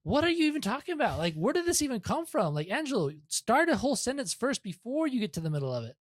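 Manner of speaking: retelling his incredulous reply to the coworker, pitched well up; exasperated retort. Retold or imitated. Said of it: "And I'm like".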